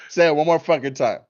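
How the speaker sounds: hammed-up indignant dare; mock outrage. Patient daring the nurse to repeat it, mock angry.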